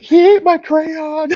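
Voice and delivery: put-on high, slow, loud cry of a little kid; shocked toddler. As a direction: wail it like a stunned three-year-old.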